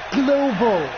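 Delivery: "global" punched hard and drawn out; stress on global. Acted. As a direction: lean all your weight on "global". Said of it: "Emphasis on".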